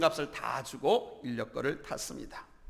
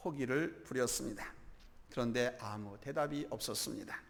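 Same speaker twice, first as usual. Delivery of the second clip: ordinary talk, nothing marked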